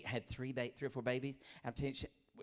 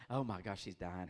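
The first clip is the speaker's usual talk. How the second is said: ordinary talk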